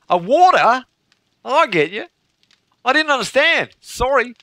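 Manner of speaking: high-pitched mock-Australian accent; Australian waitress. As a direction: play an apologetic Australian waitress, pitched well up. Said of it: "the waitress couldn't understand".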